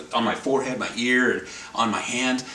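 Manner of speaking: ordinary delivery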